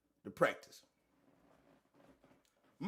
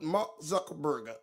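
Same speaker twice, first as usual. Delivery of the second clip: a lightly done [name] impression, a bit lower and slower; 6 semitones lower, 3 dB quieter, slower than usual. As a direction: drop into a hint of [name]'s drawl on the name. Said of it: "in my [name] voice".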